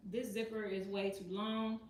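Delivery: normal talking voice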